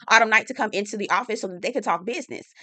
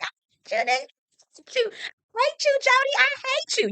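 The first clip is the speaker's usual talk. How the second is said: sobbing mock cry. Recapper mimics Haley wailing while being held. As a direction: wail it out through sobs. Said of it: "She's like".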